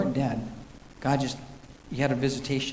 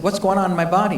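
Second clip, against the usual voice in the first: retold scream; 5 semitones higher, 6 dB louder than usual. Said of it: "he started screaming".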